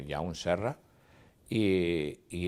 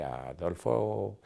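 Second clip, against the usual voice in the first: normal talking voice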